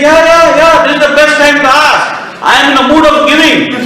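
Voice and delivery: high, brisk and eager king; a joyful king keen to give, pitched high and quick; eager generous king. Acted. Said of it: "He said".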